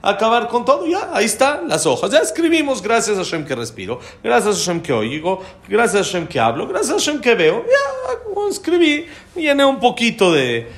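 high, excited and rushed, breaking into laughter; mock-eager family members racing through thanks; eager kids' voices. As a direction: rattle it off high and giddy. Family members rushing to finish.

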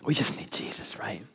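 soft whispered conviction; intimate whisper. Real, not acted. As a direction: lean in and whisper it. Preacher drops to whisper.

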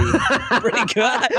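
high, slow echo through laughter; mimicked baby talk. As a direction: parrot the cutesy voice, laughing. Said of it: "his voice went to this weirdest".

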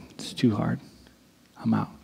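half-hearted quitting sigh; mock quitter. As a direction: let it sag like giving up. Quitter voice, imagined.

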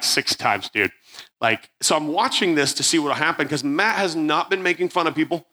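ordinary talk, nothing marked

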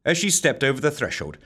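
ordinary delivery

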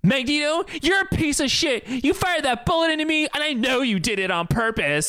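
high, heated accusation in a hammed-up Xavier voice; mock angry outburst. Friend retelling Xavier confronting Magneto, mock angry high voice. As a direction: yell the blame at him, half-joking.

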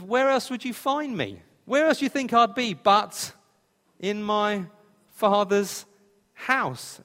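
put-on child answering as if it were obvious; Jesus answering. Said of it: "Jesus looks at them and says".

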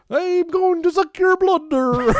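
high, slow, hammed-up Irish vampire accent; bad Irish vampire accent. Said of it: "Irish accent, but like vampire".

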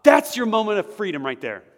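high, loud, driving sermon voice; full-force preaching. Acted. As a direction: preach it up to the rafters.

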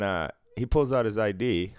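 ordinary talk, nothing marked

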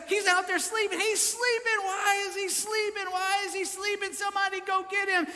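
very high, frantic disciple voice; mock-panicked, high-pitched pleading; freaking out. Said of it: "they're freaking out".

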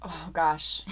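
normal talking voice

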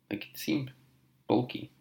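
whispering. Real, not acted. Quiet aside.

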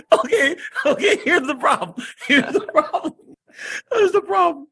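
laughing. Real, not acted. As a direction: giggle then half-shout. Laughing after Booker's promo.

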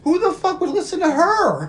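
high, loud mock disbelief; incredulous mock outburst. Scoffing at her directing traffic, incredulous high outburst. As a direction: blurt it out in exaggerated outrage.

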